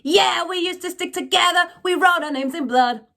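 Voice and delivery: high, loud, heavily distorted sung line; heavy distorted rock voice. Shown as a demonstration. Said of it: "right, like that".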